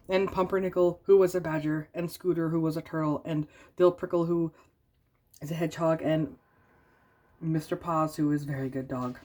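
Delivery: plain reading voice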